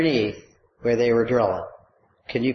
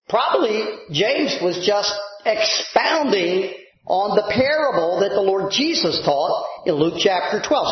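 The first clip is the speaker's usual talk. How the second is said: usual speaking voice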